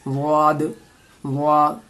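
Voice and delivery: usual speaking voice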